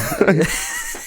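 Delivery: sudden high-pitched burst of laughter. Real, not acted. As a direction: squeal with laughter. Laughing at the joke.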